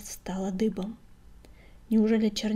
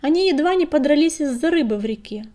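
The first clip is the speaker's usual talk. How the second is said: louder, higher-pitched shout; calling out; 6 semitones higher, 8 dB louder than usual. A narrator's reading. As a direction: call it out over the crowd. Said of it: "крикнул Крутобок". (выкрик повышенным голосом)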